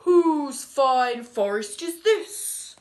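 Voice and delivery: loud, slow, regal king voice; grand king. Acted. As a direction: boom it out like a king.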